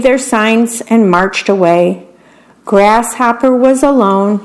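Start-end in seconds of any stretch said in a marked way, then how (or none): none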